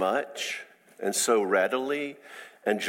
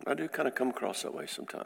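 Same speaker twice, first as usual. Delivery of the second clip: sheepish admission; 10 dB quieter, much faster than usual. Retold or imitated. Judgy guy concedes after sigh.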